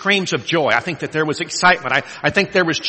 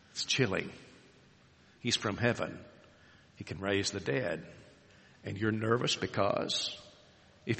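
hushed awe; 8 semitones lower, 15 dB quieter than usual. Acted. Preacher lowers voice for effect.

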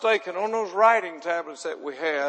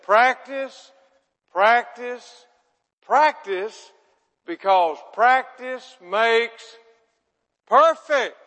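each practice punched louder and higher, slowing into perfect; emphatic teacher; 4 semitones higher, 5 dB louder, much slower than usual. Retold or imitated. Teacher drilling her pupils, emphatic and building. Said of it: "And then she said".

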